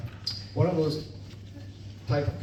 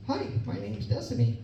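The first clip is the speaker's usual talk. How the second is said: put-on high, soft little-girl voice; 11 semitones higher, 7 dB quieter than usual. Dad retelling his daughter's words in her voice. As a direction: pipe it up like a small child.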